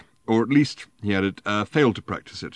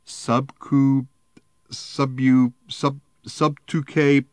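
put-on drunken stumble; 6 semitones higher, 5 dB louder, much slower than usual. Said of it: "he paused for breath".

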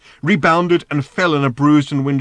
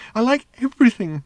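high, louder swooning moan; moaning robot; 12 semitones higher, 4 dB louder than usual. A narrator's reading. The robot swooning at Ford's questions, high and moaning. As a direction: moan with blissful delight. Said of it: "moaned the robot".

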